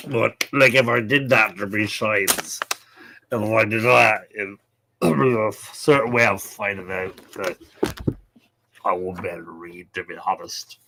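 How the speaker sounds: low, slurred mock-drunk speech; hammed-up drunken ramble; pretend drunk. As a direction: play tipsy, dragging and blurring the words. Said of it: "if I was drinking neat vodka".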